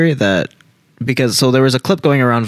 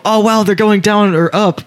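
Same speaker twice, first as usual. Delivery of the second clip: hammed-up fake amazement, pitched up; mock surprise; 6 semitones higher than usual. To listeners of the edited episode, mock surprise. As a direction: pretend to react live to the game. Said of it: "we're gonna go".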